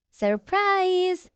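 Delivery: high, bright shout of surprise; spring the surprise with a high shout. Acted. Friends jumping out at George's party, high shout.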